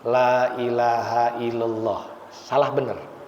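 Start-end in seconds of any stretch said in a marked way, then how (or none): 0.1-2.1 la ilaha illallah said slowly with no doubled l in illallah; wrong reading. Shown as a demonstration. Preacher showing a wrong talqin reading, no tasydid. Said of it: "bacanya misalkan begini".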